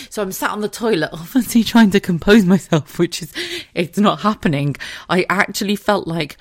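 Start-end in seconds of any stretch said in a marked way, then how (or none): none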